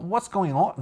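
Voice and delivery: clumsy high-pitched Scouse attempt; awful Liverpudlian accent. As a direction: try Liverpudlian and miss badly. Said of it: "No, that was awful".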